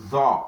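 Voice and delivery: "The" as a heavy "tho" from the throat; heavy, throaty "The"; emphatic letter. Shown as a demonstration. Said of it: "They come directly from the throat".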